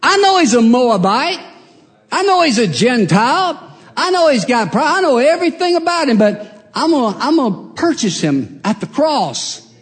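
loud, high, triumphant declaration; Jesus claiming the sinner. Acted. Jesus declares he will buy back the sinner, loud and triumphant. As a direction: proclaim it at full force, rising high. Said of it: "And he said".

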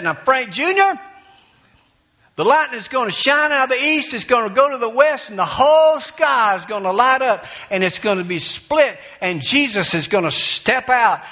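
put-on high, loud fervent old-time preaching; granny on the porch warning her grandson of the rapture, fervent. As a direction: thunder out the end times like a Pentecostal granny. Granny preaching the Second Coming.

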